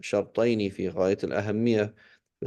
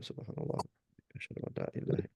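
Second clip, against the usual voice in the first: whispered; 6 semitones lower, 14 dB quieter, much faster than usual. Real, not acted. Drowsy closing prayers, breathed out.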